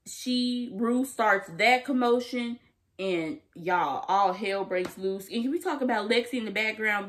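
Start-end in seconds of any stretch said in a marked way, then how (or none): none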